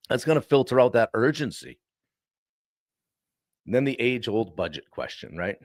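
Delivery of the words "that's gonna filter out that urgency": ordinary delivery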